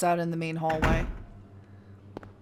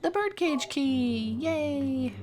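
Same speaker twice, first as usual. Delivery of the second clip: excited; 8 semitones higher, much slower than usual. Pretend. Mock excitement over key.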